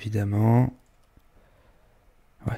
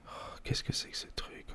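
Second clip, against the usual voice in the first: whispering; 20 dB quieter than usual. Acted. Whispered reaction while recording foley.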